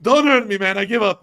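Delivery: loud, high mock pleading; hammed-up begging to give up; begging surrender. Russian surrendering to the kid in the bush, loud mock pleading. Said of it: "He's gonna be like".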